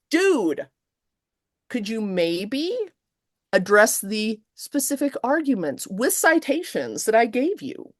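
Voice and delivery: pointed, dragged request; exasperated patience. Retold or imitated. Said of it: "She writes back and is like".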